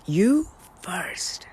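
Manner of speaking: hushed whispered echo. Shown as a demonstration. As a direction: breathe it as a secret. Whispered repeat of key phrase.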